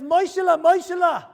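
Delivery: loud, high urgent wake-up call; calling a sleeping friend's name, loud and high. Retold or imitated. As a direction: shake him awake with his name. Friend waking sleeper at night.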